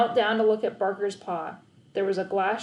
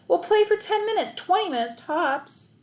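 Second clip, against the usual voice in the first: eager persuading; 9 semitones higher, 3 dB louder than usual. A narrator's reading. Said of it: "said Eric".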